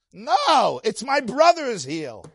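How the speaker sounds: high, drawn-out correction; acted insistence. Acted child answering about his name.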